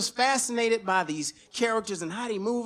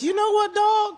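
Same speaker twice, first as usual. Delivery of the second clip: high, loud call to the other man; high mock callout; 11 semitones higher, 7 dB louder than usual. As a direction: call him out, pitched up and loud. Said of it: "And I'm like".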